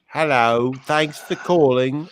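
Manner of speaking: imitating a British dude's voicemail greeting. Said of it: "that's exactly what it sounded like, except, except it was a dude".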